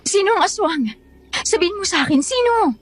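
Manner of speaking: high, urgent questioning; desperate insistence on an answer; insistent demand. Acted. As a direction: demand the name, pitch climbing.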